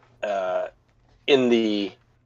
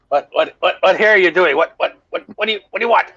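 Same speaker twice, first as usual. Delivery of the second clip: put-on flustered old fisherman, higher and louder; startled fisherman; 7 semitones higher, 3 dB louder, faster than usual. Fisherman sputtering at the stranger who jumped aboard, flustered. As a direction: sputter at the stranger in your boat.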